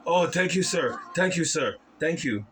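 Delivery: high, eager, bowing thanks; overjoyed servant. Acted. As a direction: gush with relief and gratitude.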